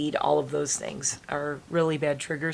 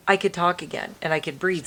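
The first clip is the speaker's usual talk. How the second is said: ordinary talk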